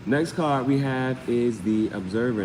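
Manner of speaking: ordinary talk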